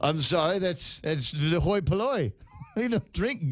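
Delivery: a lightly voiced snob, slow and haughty; snooty snob voice. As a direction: sniff at the hoi polloi like a hammed-up aristocrat. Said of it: "you have to say it like that".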